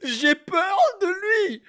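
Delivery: high, drawn-out frightened whine; mock fear. Reactor tells viewers the rapper scares him, hammed-up fear. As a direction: play up being scared, stretched out and high.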